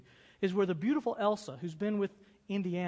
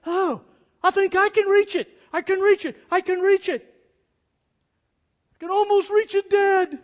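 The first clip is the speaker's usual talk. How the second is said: much higher and louder, fixated on the grail; hammed-up hero reaching for the prize; Indy straining; 11 semitones higher, 13 dB louder than usual. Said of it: "he goes".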